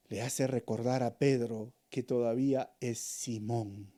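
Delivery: ordinary talk, nothing marked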